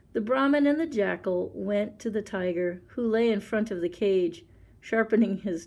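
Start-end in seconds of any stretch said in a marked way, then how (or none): none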